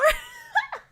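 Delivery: very high, shrill burst of laughter; high shrieking laugh. Real, not acted. Streamer shrieks with laughter at chat's joke.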